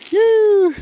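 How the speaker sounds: high, loud yelp at the dog; sharp yell. Real, not acted. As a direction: bark a sudden call to the dog.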